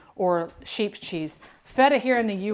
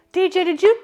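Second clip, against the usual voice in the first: high, loud call across the house; 10 semitones higher, 8 dB louder than usual. Real, not acted. Mom calling her son across the house about the knife, loud and high. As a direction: call out to him, sharp and high.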